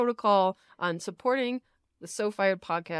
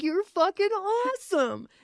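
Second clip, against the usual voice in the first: emphatic praise; 9 semitones higher than usual. Retold or imitated. Affirming a friend emphatically.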